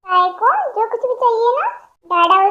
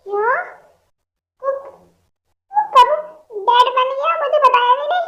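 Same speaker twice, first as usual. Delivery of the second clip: shocked disbelief; 6 semitones higher than usual. Acted. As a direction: blurt it out in total shock. Shocked friend became dad.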